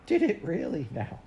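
high, sympathetic lilt; kindly. A narrator's reading. Missus Biddle hears of the carpet mistake, kindly. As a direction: sympathise sweetly, pitched well up. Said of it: "said Missus Biddle kindly".

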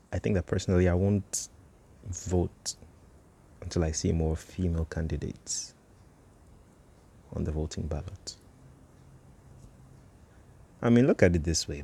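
normal talking voice

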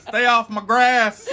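put-on high, slow, loud cranky old geezer; old prospector. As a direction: holler like an old man shooing kids. Mocking grumpy old man.

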